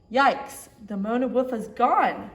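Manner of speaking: loud alarmed cry; startled loud cry from the museum director. Acted. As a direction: shout it in alarm at the theft. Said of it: "cried the museum director".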